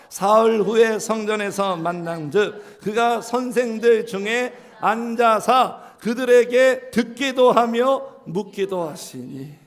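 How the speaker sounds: slow, loud unison reading; slow loud scripture recitation. Acted. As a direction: read the verse out together, slowly. Congregation reads scripture aloud.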